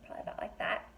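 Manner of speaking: soft, fast, whispered aside. Real, not acted. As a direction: drop to a whisper for a quick aside. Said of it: "aside to listener".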